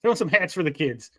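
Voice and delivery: ordinary delivery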